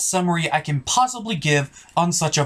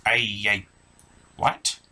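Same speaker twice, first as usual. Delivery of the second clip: ordinary delivery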